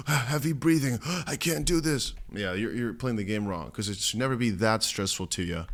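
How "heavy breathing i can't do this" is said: hammed-up stressed panting, raised pitch; mock panic. Trader imitating someone stressing out heavily, mock panic with heavy breathing. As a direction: gasp through it as if hyperventilating. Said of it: "If you're really stressing out heavily".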